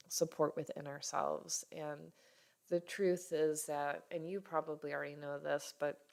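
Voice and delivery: normal talking voice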